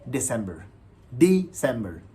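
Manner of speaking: दिसंबर as dee-SEM-ber; English pronunciation. Shown as a demonstration. Teacher modelling the easy last month, दिसंबर as dee-SEM-ber. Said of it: "pronunciation है".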